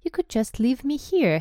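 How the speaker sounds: tentative hopeful plea. A narrator's reading. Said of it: "Harry put in hopefully".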